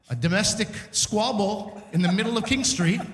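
ordinary talk

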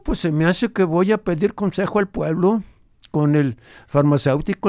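put-on old grandfather. Grandfather recalls his ranch for the radio host, lightly voiced old man. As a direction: tell it as the old abuelo remembering his ranch.

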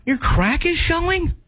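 high, drawn-out disappointed question. Pretend. Co-host acting for the host, disappointed. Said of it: "I'm just disappointed".

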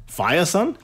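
Firesun with "fire" first, rising as a question; alternative pronunciation. Shown as a demonstration. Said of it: "Could be Firesun".

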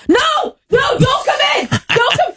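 very high mock-panicked cry; panicked yelling. Host retelling bathroom blunder, panicked high yell. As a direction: frantically call them off, voice shooting up. Retold panic at knock on door.

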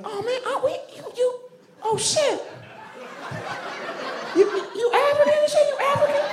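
shrieky falsetto, way up high; very high, incredulous mock Southern voice; high-pitched Southern surprise. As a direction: squeal it like a stunned Southerner. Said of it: "their voices go up really high".

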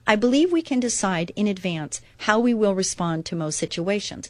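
ordinary talk

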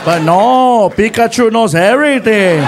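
loud, sarcastic backseat buddy; Felipe's voice. Retold or imitated. Friend saying I told you so, loud scolding. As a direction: rub it in loudly as the friend. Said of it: "my friend Felipe is like".

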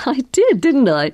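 half-laughing, high-pitched agreement; amused, starting high. A narrator's reading. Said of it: "Cardi laughed".

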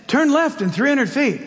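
lightly imitated GPS navigation voice; a hint of a female satnav giving directions; GPS girl's voice. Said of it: "it's in a girl's voice".

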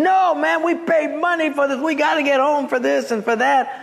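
irate passenger griping at the gate; angry passengers. Retold or imitated. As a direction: imitate furious travellers demanding their money's worth. Angry stranded passengers complaining.

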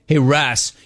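ordinary talk, nothing marked

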